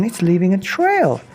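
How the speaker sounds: put-on children amazed at the trail; excited children. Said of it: "said the children".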